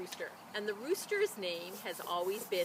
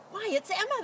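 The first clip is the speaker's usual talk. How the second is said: high, louder surprised cry; 12 semitones higher, 5 dB louder than usual. A narrator's reading. Farmer's wife spotting Emma at the window, surprised high cry. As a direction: exclaim in surprise at the hen. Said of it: "farmer's wife cried".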